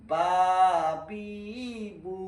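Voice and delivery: বা বি বু stretched with long pauses between; slow drawn-out syllables. Shown as a demonstration. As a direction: drag the syllables out slowly, the wrong way. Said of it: "তাহলে পড়া সহি হবে না". (বা বি বু টেনে টেনে ধীরে পড়া)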